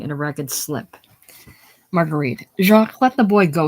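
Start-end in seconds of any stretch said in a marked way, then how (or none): 2.6-3.7 loud, high plea to stop; urgent protest. Acted. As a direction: call out sharply to protect the boy. Woman pleads for seized boy.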